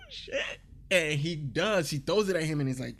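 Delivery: ordinary delivery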